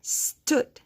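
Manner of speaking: "stood" as a long "sss", then "tood"; sounded out. Shown as a demonstration. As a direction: draw out the S of stood, then pause. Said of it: "How many vowel sounds".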